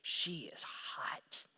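soft breathy whisper of admiration; hushed aside. Retold or imitated. An onlooker whispering about an attractive woman. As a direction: whisper it like sizing someone up. Pastor voicing a smitten onlooker.

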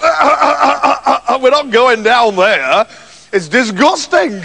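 laughing through the words; sneering laugh at the idea; mocking laughter. Brothers laughing at him.

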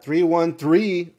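loud, slow, excited number callout. Real, not acted. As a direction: call it out, thrilled. Finds a close guess in chat.

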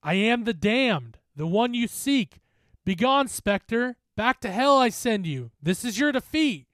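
loud, high banishing chant; forceful, ringing command; shouted incantation. A narrator's reading. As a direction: shout the spell with conviction. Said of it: "I shouted again".

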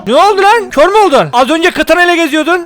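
surprised yelling. Real, not acted. Enemy suddenly blind.

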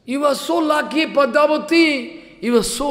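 high, loud cry of wonder; ecstatic praise. Acted. Jayadev praising his wife's good fortune, ecstatic. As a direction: marvel aloud, stretching out the name.

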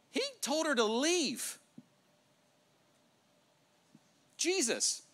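high, loud incredulous proclamation; emphatic preaching. Real, not acted. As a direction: drive the startling point home, drawn out and forceful. Preacher stresses his startling point.

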